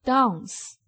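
"dance" with the long British "ah", dahnce; teacher models "dance" the British way; British pronunciation. Shown as a demonstration. Teacher demonstrating vowel difference, British "dance". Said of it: "英国英语".

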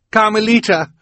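high, loud, desperate call; urgent cry. A narrator's reading. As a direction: cry out to stop her. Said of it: "Violet cried".

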